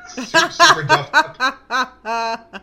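high, slow hammed-up posh voice; posh British. Laughing, a lightly voiced posh Brit to friends. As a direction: drawl it out like a fancy Brit. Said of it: "it just sounds classy when you say it like that".

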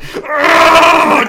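a high, loud raging "Argh!"; angry gamer roar. Retold or imitated. Gamer recalls raging at the last boss, shouting. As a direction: let the frustration burst out as a roar. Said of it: "making these noises".

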